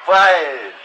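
ordinary delivery

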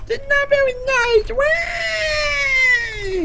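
very high crying squeal parroting his friend; whiny mock imitation. Mocking his friend's complaint, high whiny wail. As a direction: wail it back at him like a baby.

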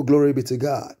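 ordinary delivery

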